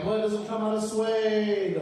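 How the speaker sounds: high, loud, drawn-out wail; mock dying cry. Victim's last words to killers, mock dramatic cry.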